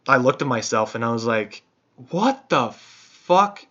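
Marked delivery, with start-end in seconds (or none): 2.1-3.6 drawn-out high what the fuck; slow, high-pitched disbelief; shocked. Retold or imitated. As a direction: stretch it out in disbelief. Self-questioning in mirror.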